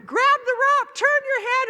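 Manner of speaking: high, loud yelled instructions; panicked shout of the rope drill. Retold or imitated. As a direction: yell the steps at yourself. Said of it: "and I hear".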